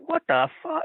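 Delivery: high-pitched retold bafflement; mock incredulous question; imitated disbelief. Acting Ricky and Robert's disbelief.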